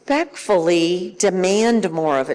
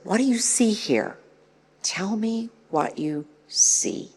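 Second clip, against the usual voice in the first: coaxing questioner; 4 semitones higher, 5 dB quieter than usual. Shown as a demonstration. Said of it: "Asking questions like".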